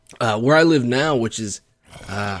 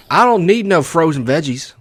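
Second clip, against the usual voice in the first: hammed-up East Texas accent; harsh Texas accent; 3 semitones higher, 4 dB louder than usual. Gamer imitating a grocery store shopper, harsh East Texas accent. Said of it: "sound like somebody's terrible Texas accent".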